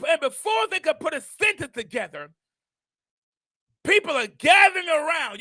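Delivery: ordinary talk, nothing marked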